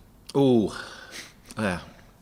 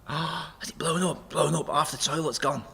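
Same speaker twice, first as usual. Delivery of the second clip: mock shock; 7 semitones higher, 4 dB quieter than usual. Acting out shocked gossip about toilet.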